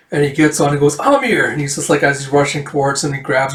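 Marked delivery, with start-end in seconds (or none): 1.0-1.5 high-pitched calling of a name; urgent call. Retold or imitated. Rider calls to woman as he rushes up, urgent.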